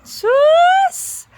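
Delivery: high, loud surprised cry; surprised exclamation. Real, not acted. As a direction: shriek it in surprise. Reacts to being recognized.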